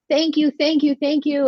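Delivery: loud, high-pitched grateful outpouring; imitated effusive thanks. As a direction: gush thanks like the overjoyed older couple. Imitating an effusively grateful older couple.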